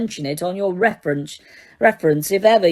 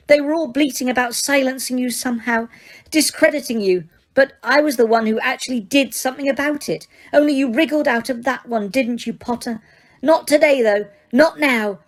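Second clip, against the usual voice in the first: high, firm enraged witch; clipped fury held in check; Umbridge voice; 5 semitones higher than usual. Acted.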